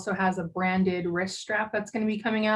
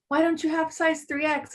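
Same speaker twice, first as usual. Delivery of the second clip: higher-pitched imitation of a complainer; mock yell; 7 semitones higher than usual. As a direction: mildly mimic someone yelling a demand. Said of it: "someone yell at me like".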